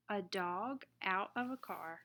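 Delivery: usual speaking voice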